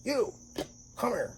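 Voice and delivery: high-pitched summons in a retold story; two short, high commands; calling someone over. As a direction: imitate a boss calling you over. Mayor summons him in story.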